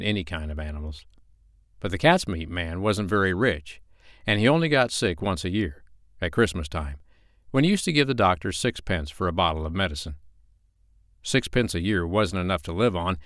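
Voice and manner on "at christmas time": plain reading voice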